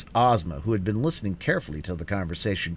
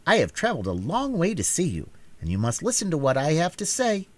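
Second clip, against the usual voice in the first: slightly higher, steady ruler's voice; calm, firm young queen; 10 semitones higher than usual. A narrator's reading. Ozma voiced in story.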